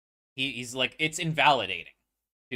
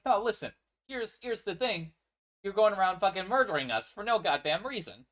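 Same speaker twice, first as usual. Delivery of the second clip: angry retort; 7 semitones higher, 3 dB louder than usual. Retold or imitated. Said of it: "And he's like".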